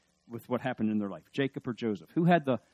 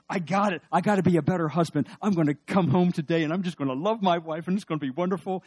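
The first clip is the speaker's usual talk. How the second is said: louder, higher eager vow; excited resolution; 7 semitones higher, 9 dB louder than usual. Retold or imitated. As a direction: hype yourself up about loving your wife. Resolving to be better husband.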